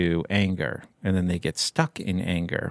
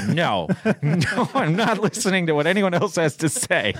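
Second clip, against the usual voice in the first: higher-pitched, playful digging in; mock stubborn refusal; 7 semitones higher than usual. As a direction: pretend to refuse to listen, with a laugh.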